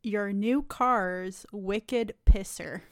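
mock Boston phrase read off Google; Boston accent. Reads a Google Boston phrase in slow mock Boston accent. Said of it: "Boston phrases".

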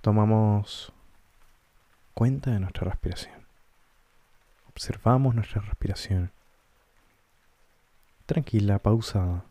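calm guided-meditation voice with long pauses. Acted. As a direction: guide listeners gently inward, leaving space between short phrases. Guía una meditación con pausas. (voz pausada de meditación guiada)

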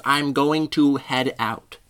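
GOING TO drawn out slowly, word by word; mocking grammar correction. Speaker mocks the grammar fixer, slowly over-enunciating GOING TO. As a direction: pronounce "going to" with slow, exaggerated care. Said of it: "You don't gotta fix my grammar here".

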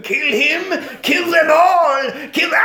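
very high, frenzied mock shriek; shouting doll head. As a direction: scream orders to your headless body. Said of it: "the head is shouting at the body".

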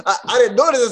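usual speaking voice

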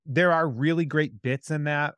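usual speaking voice

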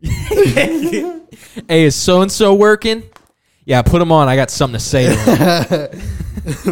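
loud, high prank call voice; loud phony caller, laughing through it. Pretend. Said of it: "call our friends and be".

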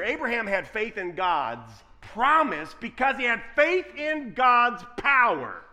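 ordinary talk, nothing marked

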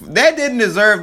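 high laughing disbelief. Real, not acted. Laughing reaction to weak line.

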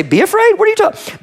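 high, incredulous "Be afraid"?; mock disbelief. Pastor questioning Jesus' words, incredulous and high. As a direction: squeak it out in disbelief. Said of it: "mock disbelief".

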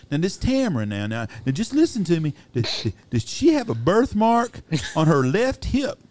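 high, slow, hesitant caller; hammed-up prank caller probing; mock prank caller. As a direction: drag it out high like a sly caller. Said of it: "He says".

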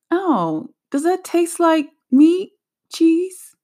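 high, slow, mocking question voice; mocking annoying question. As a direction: mimic a nosy asker, drawn out. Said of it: "I'm really irritated when people say".

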